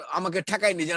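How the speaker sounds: ordinary talk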